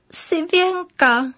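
high, slow little voice that cracks; voice breaking. Acted. Timid dove says her name to the teacher, voice cracking. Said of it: "náhle se jí hlásek zlomil".

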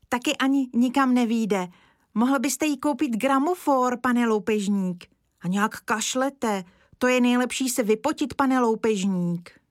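put-on a scared boy chattering to a stranger; nervous little boy. Kája keeps chattering.

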